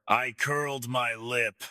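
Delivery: ordinary delivery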